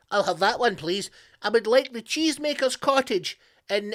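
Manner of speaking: high-pitched, put-on gushing request; mock eager customer. Witness picking a cottage from an Airbnb book, high mock-eager voice. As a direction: play a delighted chooser, pitched well up. Mocks character choosing witness-protection home.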